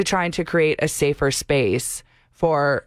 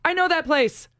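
excited; 10 semitones higher than usual. Retold or imitated. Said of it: "It was so cool to be like".

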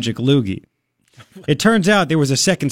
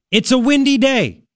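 higher, slow, innocent-sounding alibi; mock suspect's excuse; 6 semitones higher than usual. Host mimicking a suspect's excuse to police, higher and slow. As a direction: play dumb with a far-fetched excuse. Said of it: "She said, quote".

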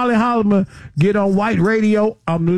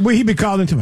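ordinary talk

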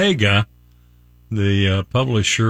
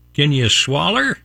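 hillbilly twang on a raised pitch; hillbilly accent; 4 semitones higher than usual. Acted. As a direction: ask it like a backwoods hillbilly. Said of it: "I've gotta use a hillbilly accent".

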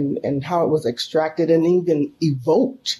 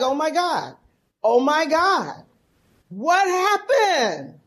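tearful dismay; 8 semitones higher, slower than usual. Retold or imitated. Hurt daughter reacts, crying.

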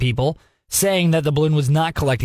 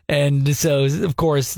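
ordinary delivery

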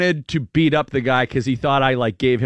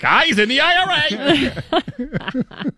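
mock alarm; 13 semitones higher, much slower than usual. Imagined alarmed thought.

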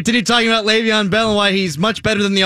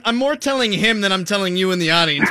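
normal talking voice

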